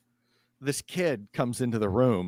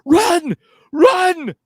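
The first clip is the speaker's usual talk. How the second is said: high, full-force warning yell; panicked shout; 12 dB louder than usual. Acted. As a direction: scream at them to flee. Henrietta screams at fleeing kids.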